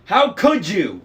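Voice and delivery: furious. A narrator's reading. Annabeth furious at Luke for poisoning the tree. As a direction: explode at the traitor. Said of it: "Annabeth sounded so angry".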